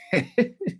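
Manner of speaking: high-pitched burst of laughter; high, amused giggle. Real, not acted. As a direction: laugh out high at a funny comment. Amused by lookalike comment.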